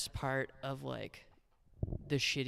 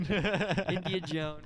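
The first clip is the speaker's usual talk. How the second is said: laughing; 4 semitones higher, 5 dB louder, much slower than usual. Pretend. Savoring joke spin-off name.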